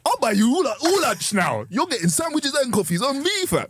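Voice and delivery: excited offer. Retold or imitated. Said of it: "I was like".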